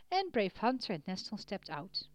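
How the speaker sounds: usual speaking voice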